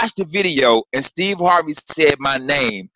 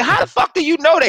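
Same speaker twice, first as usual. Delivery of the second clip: stunned disbelief; 12 semitones higher than usual. Retold or imitated. Disbelief at winning news.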